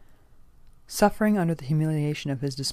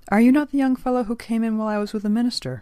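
lightly voiced elder, high and loud; inquiring old gentleman; 8 semitones higher, 8 dB louder than usual. A narrator's reading. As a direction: ask with raised, formal curiosity. Old gentleman questions the young man.

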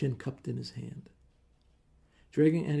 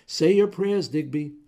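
loud threat; 5 semitones higher, 11 dB louder than usual. Acted. Husband threatening his rival.

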